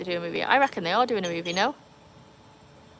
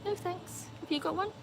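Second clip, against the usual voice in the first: dog's voice; 11 semitones higher, 8 dB quieter than usual. Pretend. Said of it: "She's like".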